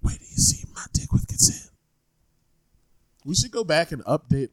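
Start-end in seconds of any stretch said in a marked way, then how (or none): 0.0-1.7 seductive whisper. Acted. Whispered bit repeated.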